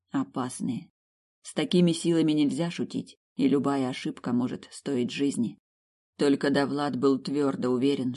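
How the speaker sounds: plain reading voice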